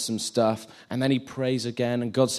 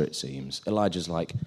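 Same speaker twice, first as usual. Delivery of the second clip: normal talking voice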